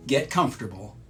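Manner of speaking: usual speaking voice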